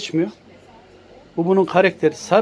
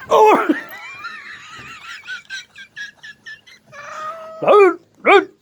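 startled yelp; slower than usual. Real, not acted. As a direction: jump at the scratch. Cat strikes suddenly.

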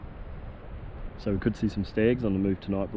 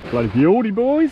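loud and high, thrilled cheer; delighted; 11 semitones higher, 7 dB louder than usual. Real, not acted. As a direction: cheer the camp, voice jumping up. Delighted at camp.

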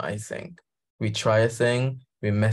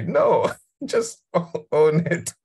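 laughing through the words; amused, laughing insistence; 6 semitones higher, slower than usual. Real, not acted. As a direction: laugh as you tell them to own it.

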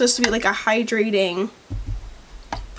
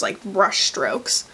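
normal talking voice

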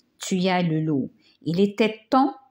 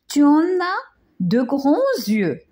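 put-on higher, wondering little girl; a child marvelling at grandma's eyes, higher; 7 semitones higher, 3 dB louder than usual. A narrator's reading. Little girl marvels at the disguised wolf, higher voice.

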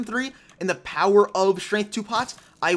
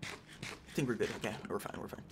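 hushed whisper to himself; whispered reassurance; 10 semitones lower, 16 dB quieter than usual. Real, not acted. Gamer whispering to himself that he is safe. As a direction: whisper it, calming yourself down. Nervous self-reassurance in game.

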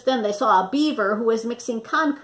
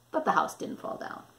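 hushed; 4 semitones lower, 8 dB quieter, faster than usual. A narrator's reading. Hushed after the huffing.

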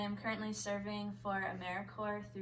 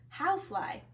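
higher-pitched troll question; roaring trolls; 7 semitones higher, 6 dB louder than usual. A narrator's reading. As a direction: demand it like a baffled troll. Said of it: "the trolls roared".

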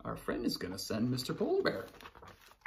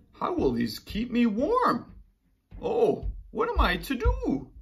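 despairing; 11 semitones higher, 6 dB louder than usual. Acted. Polar bear despairs.

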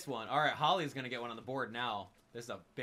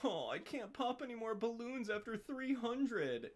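high, mocking player whine; whining complainer; 8 semitones higher, 4 dB quieter than usual. Streamer mocking players who complain about balloons, high whine. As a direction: mimic a petty complaint in a high, whiny voice. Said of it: "Yo, you've been grinding".

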